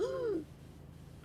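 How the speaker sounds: sharp high audible gasp; imitated panicked gasp. Back-seat passenger reacting to a truck, mock panicked gasp. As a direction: mimic a nervous passenger's gasp. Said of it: "and audibly gasping".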